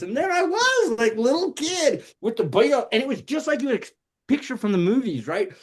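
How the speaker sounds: usual speaking voice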